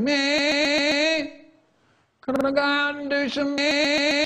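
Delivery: put-on high, whining child's voice; whiny kid. Whiny kid begging the teacher to go out, high and whining. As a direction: whine it like a bored pupil. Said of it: "a whiny kid going".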